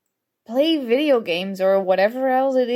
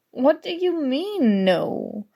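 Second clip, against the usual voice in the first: ordinary talk, nothing marked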